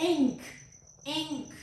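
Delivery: normal talking voice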